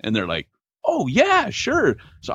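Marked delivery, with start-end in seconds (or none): 0.8-2.0 high-pitched, eager agreement; sugary imitation of a neighbour; obliging candy giver. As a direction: gush it sweetly, pitched way up.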